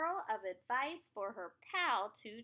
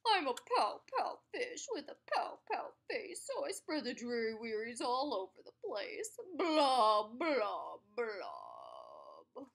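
glum pout fish; 5 dB louder than usual. Acted. The pout fish moaning about his dreary wearies, glum. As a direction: drag it out like a sulky fish. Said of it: "glum, gloomy swimmer with an ever-present pout".

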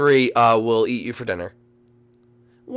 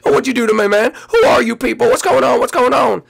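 squeaky and much higher, louder; alarmed bird voice; 11 semitones higher, 5 dB louder than usual. Acted. Bird demanding answers from its rescuers, high and loud.

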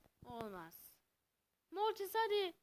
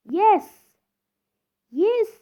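cheer; 8 semitones higher, 20 dB louder than usual. Real, not acted. Cheering a win.

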